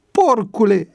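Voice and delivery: high, loud furious insult; angry outburst. A narrator's reading. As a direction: explode at him. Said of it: "izbucni plutonierul".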